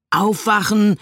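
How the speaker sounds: sudden roar. A narrator's reading. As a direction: jolt her awake with a shout. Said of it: "brüllte der Traumtänzer plötzlich".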